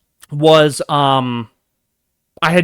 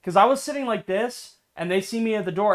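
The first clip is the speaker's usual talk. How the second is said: normal talking voice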